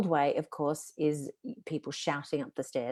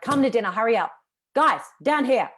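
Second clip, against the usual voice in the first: loud, high, barking orders up the stairs; harsh shouted summons to the kids; bossy dinner call; 6 semitones higher, 7 dB louder than usual. Shown as a demonstration. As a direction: demand they come down now. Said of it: "If I did that sort of tone of voice".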